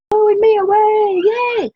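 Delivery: high, loud gleeful whoop; delighted yell about the wind; excited cheer. Real, not acted. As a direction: shout it for joy. Joyful cry about the wind.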